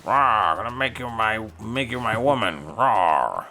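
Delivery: lightly growled monster grunts; Frankenstein's monster. Pretend. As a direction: lumber through it like the stitched-up monster. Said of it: "That's my Frankenstein's monster impression".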